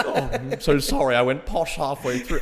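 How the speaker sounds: laughing apology in British English; Tom Holland. Retold or imitated. Actor apologises for going posh, loud British imitation. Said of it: "he just breaks into English".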